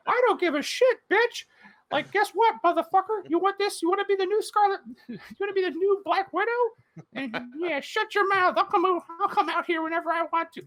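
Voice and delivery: foul-mouthed Mickey taunting the actress; Mickey Mouse voice. Retold or imitated. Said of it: "it's a Mickey Mouse terrible costume".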